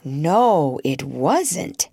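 grave little contradiction, drawn on "wasn't"; understated solemn objection. A narrator's reading. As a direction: correct her seriously, at an even pitch. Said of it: "Jane said gravely".

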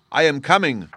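loud, high called-out answer; calling back. A narrator's reading. Cat answers the injured girl's shout, calling out loudly. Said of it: "was the answer".

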